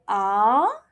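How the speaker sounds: AH slanting upwards; long AH with a rising tune; second tone. Shown as a demonstration. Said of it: "it will be slanting upwards".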